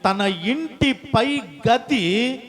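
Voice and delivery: loud, slowing solemn reading; stately Bible reading; scripture reading. Acted. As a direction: read the verse out to the church. Scripture read aloud.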